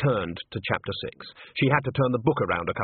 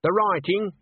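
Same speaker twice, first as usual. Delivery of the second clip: higher-pitched questioning call; mildly raised voice; 9 semitones higher than usual. A narrator's reading. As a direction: call the question through to the next room. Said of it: "she called out".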